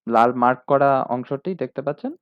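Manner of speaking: ordinary talk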